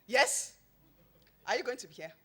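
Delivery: loud, high grumpy retort; grumpy friend. Retold or imitated. Grumpy businessman scolding her on the phone, loud and high. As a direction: bark it like an annoyed busy man. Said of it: "the grumpiest he's ever been".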